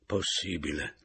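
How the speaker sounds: plain reading voice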